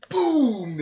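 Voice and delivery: booming, high-pitched shouted hit; loud "boom" impact noise. Retold or imitated. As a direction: shout out the blow as it lands. Acting out a hit in the retelling.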